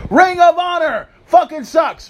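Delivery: high, loud shout; ranting shout. Real, not acted. Listing promotions that suck, a high loud shout. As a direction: yell it at the fans.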